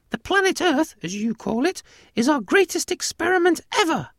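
very high, loud squeaky mouse; high-pitched cartoon mouse voice; tiny alien mouse. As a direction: squeak it out like a proud little mouse. Proud mouse boasts of experiment.

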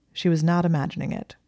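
ordinary delivery, nothing marked

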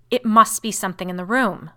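loud snap like a lash; snapping. A narrator's reading. Brother declares a cause after searching, snapping. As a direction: crack it out hard and forceful. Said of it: "in a voice which seemed to snap like a lash".

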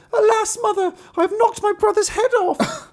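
very high, loud wailing cry; a terrified child wailing; wailing girl. Acted. Marlinkin cries to her mother after knocking head off, high wail. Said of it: "began crying and screaming".

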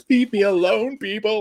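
high, exasperated mock cry; fed-up plea. Swamped by sales calls, begging vendors off in a high exasperated mock cry. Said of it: "I was just like".

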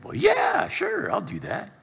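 high, bright burst of agreement; eager yes. Retold or imitated. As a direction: jump at the offer. Eagerly accepting friend's invitation.

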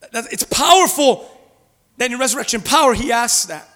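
ordinary talk, nothing marked

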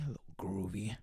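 breathy whispered repeat of a little groovy. Pretend. Whispering to himself about feeling groovy, a hushed groovy repeat. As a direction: whisper it back to yourself, grooving.

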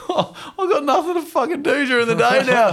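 high, laughing fake cry; mock sobbing. Parent after the last kid starts school, mock tearful wail. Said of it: "a whole wave of emotion".

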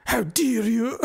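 high-pitched mock scolding; Greta Thunberg. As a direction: squeak it out in indignant parody. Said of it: "Greta Thunberg edition".

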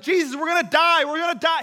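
loud, high panic; yelling. Retold or imitated. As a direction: scream it at Jesus. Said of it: "they're yelling at him".

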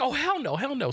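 high-pitched mock disbelief; acted-out high exclamation; retold alarm. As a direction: react with pitch jumping way up. Acting out his alarmed reaction.

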